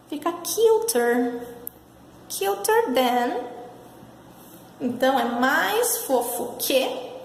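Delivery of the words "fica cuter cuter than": cuter said slowly and clearly, like a model word; teaching pronunciation. Shown as a demonstration. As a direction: spell out cuter for the class. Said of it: "tem que usar o er than".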